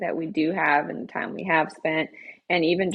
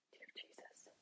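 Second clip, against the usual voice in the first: hushed, pleading mock prayer; whispered regretful prayer; 4 semitones higher than usual. Friend replaying her regret in bed at night, whispered prayer. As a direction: whisper it as a remorseful bedtime prayer.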